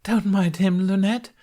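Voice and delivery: high, loud, awkward interjection; awkward cover-up. A narrator's reading. Knight cuts in to excuse the lion, awkwardly high. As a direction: cut in brightly to smooth things over, no real laugh. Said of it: "laughing awkwardly".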